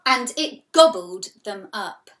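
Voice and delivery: loud jump-scare line; sudden loud burst. A narrator's reading. Teacher startling the class with the wolf's attack. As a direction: burst out after the whisper.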